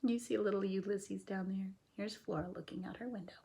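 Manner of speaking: quick whispered aside. Real, not acted. Teacher whispering to the class about the picture. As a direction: hush it while pointing out the picture.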